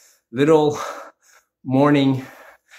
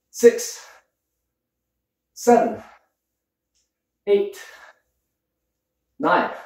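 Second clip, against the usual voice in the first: counting reps between heavy breaths; higher, strained counting through the effort; 5 semitones higher, slower than usual. Real, not acted. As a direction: gasp the numbers out mid-workout. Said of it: "I'm already out of breath".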